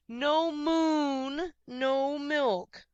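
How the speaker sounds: loud high bellowing cow; stubborn cow refrain. A narrator's reading. Cow Martha confirming her demand, bellowing. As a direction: plant your hooves and bellow it. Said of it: "Martha confirmed".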